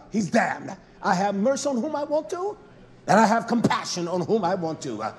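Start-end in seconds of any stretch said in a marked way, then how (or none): none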